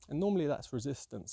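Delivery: ordinary talk, nothing marked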